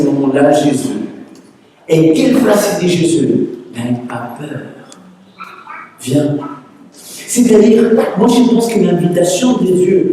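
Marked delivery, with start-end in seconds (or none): 3.7-6.4 softer, lower reassuring words; quiet comfort to a frightened man; gentle reassurance. Acted. Jesus calming Peter in the storm, soft and reassuring.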